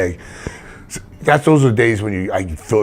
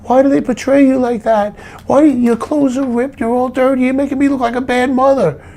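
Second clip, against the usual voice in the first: high, far-off mock mom voice; whining like an upset mother; imitating his mother; 3 dB louder than usual. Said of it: "She used to say to me".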